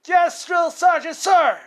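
shouted yes drill sergeant; barked military recruit reply. Retold or imitated. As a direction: yell back like a scared recruit.

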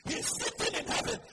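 loud, high, driving sermon; full-force preaching. Real, not acted. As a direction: hammer each statement at the congregation.

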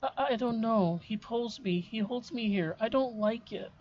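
put-on high, frightened ghost child; scared little girl.

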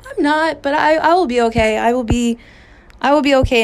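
tearful reply. Retold or imitated. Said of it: "And I'm like".

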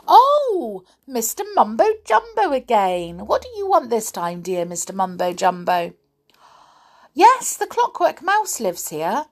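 high, exaggerated mock phone chat; doll pretending on telephone. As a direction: act delighted and shocked by an imagined caller.

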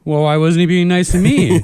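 loud, high comic complaint; mock aggrieved protest. Mentee complaining to his mentor Jeff, mock aggrieved.

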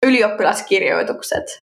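"ylioppilaskirjoitukset" as one fluent native word; Finnish pronunciation. Shown as a demonstration. Correcting co-host's attempt at the long word, Finnish pronunciation. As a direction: model "ylioppilaskirjoitukset" smoothly in Finnish. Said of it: "You wanna learn some Finnish?".

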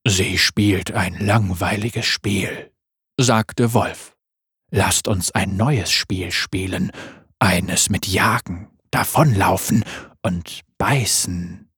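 langsam, tief und lauernd; tiefe Wolfsstimme. Acted. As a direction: ein Raubtier, das leise droht. Wolf voice again. (Wolf spricht tief und langsam)

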